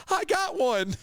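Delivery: put-on high, squealing girl grabbing his arm; excited girl voice. Girl grabbing his arm squeals excitedly. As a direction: play the girl who just caught a man.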